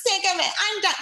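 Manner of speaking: high whiny mock rant; mocking complaint. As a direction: parody a user quitting Facebook in a huff. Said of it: "one of my pet peeves is when people get on Facebook and they're like".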